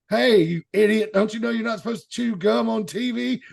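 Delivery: loud, high mock scolding; berating. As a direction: bark it like an imitated on-air telling-off. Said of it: "has heard me berate people".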